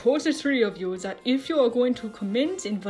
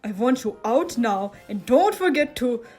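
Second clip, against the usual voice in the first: louder, scolding order; irritated; 6 dB louder than usual. A narrator's reading. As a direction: order them out, temper building. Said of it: "Ludwig grew more irritated each time".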